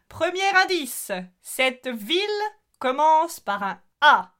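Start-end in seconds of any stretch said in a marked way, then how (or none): none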